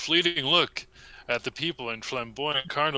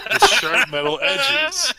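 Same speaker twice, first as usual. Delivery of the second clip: high, loud, laughing through it; can't hold the laugh; 8 dB louder than usual. Real, not acted. Cracks up reading.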